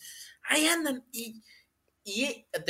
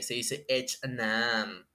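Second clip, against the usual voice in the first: "H and M" said slow, lower and softer, in English; slow, posh-sounding English "H and M"; mock English accent; 5 semitones lower, 3 dB quieter, much slower than usual. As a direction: drag out "H and M" like a snob. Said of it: "cómo se pronuncian bien las marcas".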